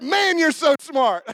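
slow, loud, high shout of awe; amazed shout. Retold or imitated. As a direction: shout it like you're blown away. Boy shouts praise.

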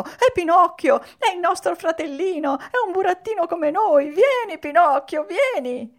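high-pitched puppet calling out; excited shout. A narrator's reading. Pulcinella calling his little brother onstage, excited high shout. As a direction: call him over, high and loud. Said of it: "Anche Pulcinella gridò".

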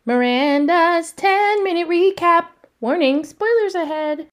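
high, loud, slow announcer intro; big jingle-style title read. Acted. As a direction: boom out the segment title.

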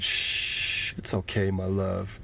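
soft whispered shushing; mother rocking a crying child, soft whisper; soothing hush. Acted. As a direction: hush him tenderly. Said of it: "she rocked him".

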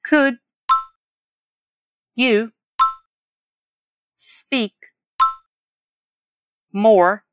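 word by word. Shown as a demonstration. Teacher repeating so learners catch it, word by word. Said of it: "Vamos repetir a frase pra pegar bem?".

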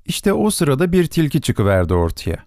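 plain reading voice

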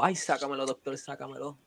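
mock whimper. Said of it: "él dijo".

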